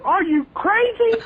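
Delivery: very high, slow, disbelieving squeal; incredulous. Retold or imitated. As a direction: stretch it out in shocked disbelief. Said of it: "I remember saying that over and over".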